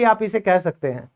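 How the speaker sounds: normal talking voice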